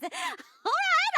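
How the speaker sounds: usual speaking voice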